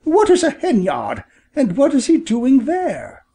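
high-pitched, puzzled mother bird; surprised, high storybook exclamation; exclaiming. A narrator's reading. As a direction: fire off questions way up high. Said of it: "exclaimed Missus Bob".

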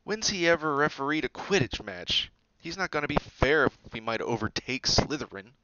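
loud, high indignant complaint; indignant outburst; spluttering protest. A narrator's reading. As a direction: protest at the unfairness. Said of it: "he spluttered through a mouthful of mud".

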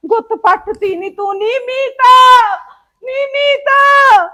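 hurt mother-in-law wails for help; wailing shout. Acted. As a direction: cry out in pain to Meeta. Mother cries out hair pulled.

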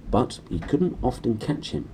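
ordinary delivery

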